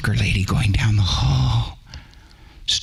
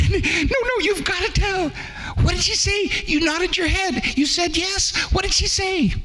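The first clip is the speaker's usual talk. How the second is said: put-on much higher, eager kids' voices; children pleading. A narrator's reading. Orphans begging the littlest orphan to reveal what she said. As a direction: beg like excited little children.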